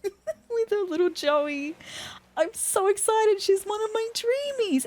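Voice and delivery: high-pitched gushing over a cute villager; excited squeal. Real, not acted. Fan meeting her dream villager, squealing excitedly.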